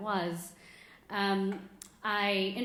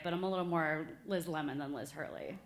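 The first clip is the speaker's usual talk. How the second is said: ordinary talk, nothing marked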